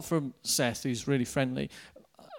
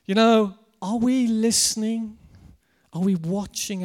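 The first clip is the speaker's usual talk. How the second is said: preaching; 6 semitones higher, 7 dB louder than usual. Real, not acted. Preacher challenges congregation.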